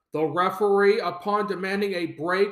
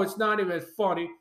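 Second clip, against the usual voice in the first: ordinary delivery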